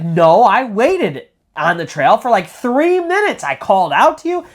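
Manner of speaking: high, stretched-out insistence in a retold quarrel; heated protest. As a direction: argue back as the indignant boy. Acted quarrel between two boys.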